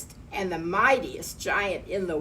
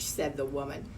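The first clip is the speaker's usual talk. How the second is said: ordinary delivery, nothing marked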